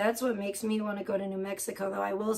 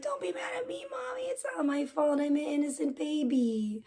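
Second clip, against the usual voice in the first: tiny, much higher, softer cat voice; voicing the cat; 6 semitones higher, 4 dB quieter than usual. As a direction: squeak it like an innocent kitten. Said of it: "she's like".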